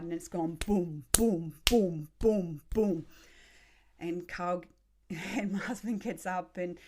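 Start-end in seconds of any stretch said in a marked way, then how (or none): 0.7-3.1 loud, punchy booms; heavy rhythmic banging, imitated loudly; imitated massive thuds. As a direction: boom out each thud of the machine. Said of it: "we could hear this massive thud".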